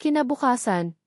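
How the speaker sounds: ordinary delivery, nothing marked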